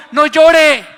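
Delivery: loud, high barked order not to cry; harsh command. Retold or imitated. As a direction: roar it down at a crying child. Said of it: "Porque Moloque decía". (orden gritada y dura: no llore)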